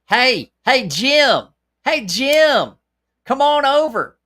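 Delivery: high, loud, sing-song hillbilly holler; sweet hillbilly voice. Pretend. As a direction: call a friend over across the yard, hillbilly style. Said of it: "call out my name in sweet, sweet hillbilly voice".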